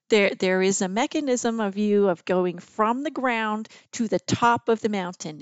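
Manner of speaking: plain reading voice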